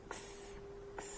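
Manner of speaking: normal talking voice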